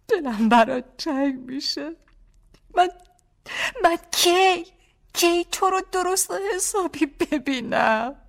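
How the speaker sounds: high, slow tearful plea; crying. A narrator's reading. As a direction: tell him you'll miss him through tears. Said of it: "با گریه گفتم".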